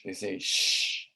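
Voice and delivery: sh hissed soft and slow in place of ch; teacher demonstrating sh; sh sound demo. Shown as a demonstration. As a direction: show the sh, drawn out and breathy. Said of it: "They don't say ch".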